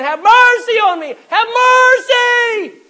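very loud, high plea, stretched on the repeat; crying out. Acted. Blind beggar calling to passing Jesus, desperate cry. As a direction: bellow it over a noisy crowd. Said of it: "Bartimaeus is crying out".

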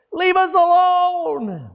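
high, loud, slow demon cry. Acted. Retelling the man crying out in church, high and loud. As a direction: wail it like the possessed man. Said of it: "a man cries out and says".

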